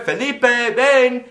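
slow, loud, high calling of his name; drawn-out call. Retold or imitated. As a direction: stretch the call out to reach him. Mother calls yet again.

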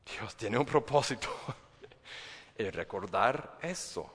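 normal talking voice

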